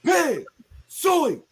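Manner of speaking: high, loud Razorback hog-calling chant. Acted. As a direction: call the hogs at full voice, way up high. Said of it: "you know what I'm gonna do".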